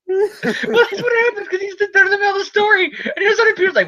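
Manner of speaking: high, laughing explanation; real laughter squeezing the voice high; talking through laughter. Real, not acted. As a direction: struggle to explain while cracking up.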